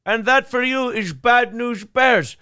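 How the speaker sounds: high, slow put-on movie voice; movie quote voice. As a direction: quote it in a hammed-up character voice. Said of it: "I go".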